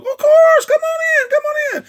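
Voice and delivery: very high, eager welcoming voice of King David. Acted. David welcomes prophet Nathan.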